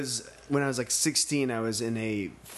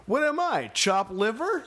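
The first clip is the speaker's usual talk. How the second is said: mock indignant; 8 semitones higher than usual. Creepy model quoted.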